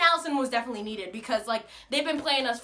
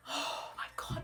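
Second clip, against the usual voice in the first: whispered; 12 dB quieter, slower than usual. Real, not acted. Sighing exasperation.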